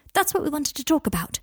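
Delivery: high-pitched, lightly voiced interruption; interjecting. A narrator's reading. Hermione cutting in on Harry and Kingsley, interjecting. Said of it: "interjected Hermione".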